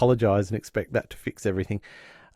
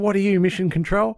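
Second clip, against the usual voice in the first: high cheeky jab; mock Alf's snark; imitating Alf sassing; sarcastic quip; 9 semitones higher, 3 dB louder than usual. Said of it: "And he goes".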